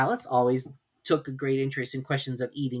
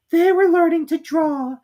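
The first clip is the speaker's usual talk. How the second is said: high, slow squeaky mouse voice; sleepy Dormouse; 9 dB louder than usual. A narrator's reading. Drowsy Dormouse telling its tale, sleepy squeak. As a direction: yawn through it, half asleep. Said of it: "yawning and rubbing its eyes".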